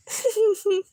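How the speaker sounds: short high giggle; brief, tittering laugh; chuckle. Real, not acted. Friend waiting with Susan, giggling.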